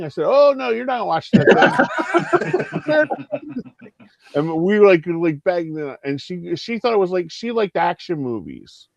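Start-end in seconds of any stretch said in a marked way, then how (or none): 0.3-3.9 laughing protest. Retold or imitated.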